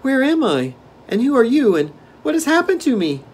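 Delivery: put-on very high, loud child's voice; exclaiming little boy. A narrator's reading. Newly freed boy questions Dorothy, exclaiming high. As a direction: pipe up high in startled bewilderment. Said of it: "the little one exclaimed".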